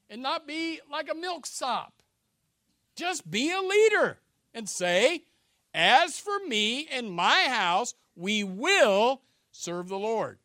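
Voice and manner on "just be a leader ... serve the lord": slow, loud, commanding proclamation; bold leader's declaration. Acted. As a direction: declare it like a man who leads his house. Preacher shouts the leader's declaration.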